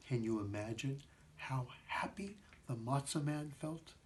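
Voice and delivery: hushed, breathy whisper. A narrator's reading. Narrator confiding to the young listener, whispered. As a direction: lean in and whisper the question.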